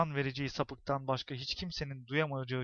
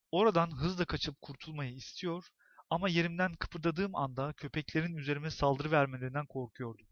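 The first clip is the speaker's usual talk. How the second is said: ordinary delivery, nothing marked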